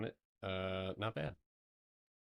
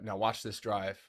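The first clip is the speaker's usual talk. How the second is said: George Bush impression. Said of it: "George Bush me".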